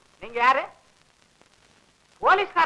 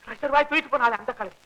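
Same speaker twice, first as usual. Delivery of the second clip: softer, toned-down echo of the line; subdued repeat; 3 semitones lower, 5 dB quieter, faster than usual. Acted. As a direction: drop the excitement and say it softly. Same words repeated, subdued.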